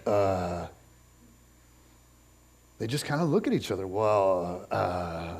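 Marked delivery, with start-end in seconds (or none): 0.1-0.7 low, halting uh of stunned disciples; awkward hesitant grunt. Retold or imitated. Disciples caught off guard by Jesus, lowered awkward grunt. 3.9-5.3 low, halting well-uh; awkward stalling. Retold or imitated. Disciples stalling before Jesus, low awkward hesitation.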